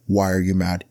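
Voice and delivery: ordinary delivery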